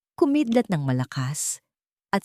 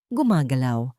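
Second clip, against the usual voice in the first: ordinary delivery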